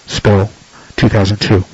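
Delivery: usual speaking voice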